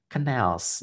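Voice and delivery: CANALS as "kuh-NELS", second syllable carrying it; corrected canals. Shown as a demonstration. Teacher repeats the correction for students, CANALS as "kuh-NELS". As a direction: model CANALS with a light first syllable. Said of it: "bisa diperbaiki menjadi canals".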